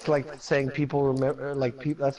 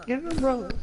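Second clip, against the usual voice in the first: pitched way up, cartoonish; high squeaky repeat; 11 semitones higher, faster than usual. Repeating joke, higher take.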